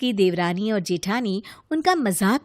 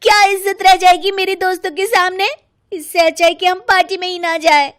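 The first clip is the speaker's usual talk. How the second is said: indignant complaint; 7 semitones higher, 7 dB louder, faster than usual. Acted. Rita complains loudly.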